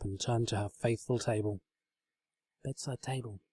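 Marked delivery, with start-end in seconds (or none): 2.6-3.4 soft hushed call; quiet whisper. A narrator's reading. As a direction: barely voice it. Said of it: "she whispered quietly".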